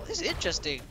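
normal talking voice

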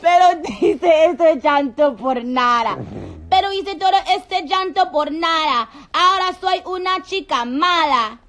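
put-on loud, high-pitched Spanish accent. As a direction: burst out in an exaggerated Spanish accent. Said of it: "Do the Spanish accent".

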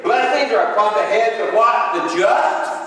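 loud, high preacher's cry, slowing on the verse; preaching at full force. Real, not acted. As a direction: preach it out to the congregation. Preaching the scripture.

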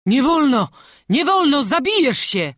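high, loud forbidding cry; frantic master's no; alarmed shout. A narrator's reading. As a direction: stop him in panic.

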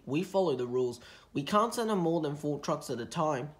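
ordinary delivery, nothing marked

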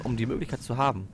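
ordinary talk

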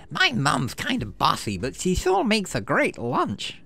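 put-on a chirpy kid bragging about lunch; cheerful Enemy. Enemy offering you melon, slow cheerful put-on voice.

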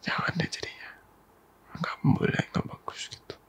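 soft breathy whisper, close to the ear. Acted. Girlfriend comforting her upset partner, whispered. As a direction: hush it gently like soothing a lover. Soothing partner in intimate roleplay.

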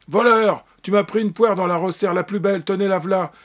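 put-on the grocer shouts the boy down as a thief; angry yell. A narrator's reading. Grocer catches the thieving boy. (crier voleur avec colère)

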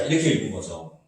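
usual speaking voice

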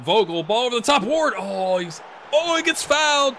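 loud, high, rushed play-by-play; excited commentary. Real, not acted. Gamer calling his striker's run on goal, excited. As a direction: voice shooting up as the attack builds. Live call of a penalty chance.

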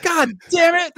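high, loud mock groan of defeat; imitating a caller's dismay. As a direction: throw up your hands in mock defeat.

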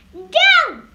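sharp high, loud protest; urgent cry. A narrator's reading. Josh tries to stop the monster eating the tree, crying out. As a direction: shout to stop it at once. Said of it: "cried Josh".

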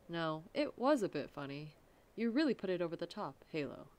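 usual speaking voice

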